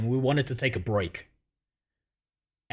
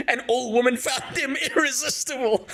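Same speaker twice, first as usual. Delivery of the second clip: high, breaking into laughter as he talks; can barely get it out for laughing; laughing through words; 9 semitones higher than usual. Real, not acted. Telling his buddy the punchline, laughing it out.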